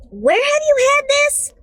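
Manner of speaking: put-on high voice for the friend. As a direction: ask it in a shrill girly voice. Friend questioning.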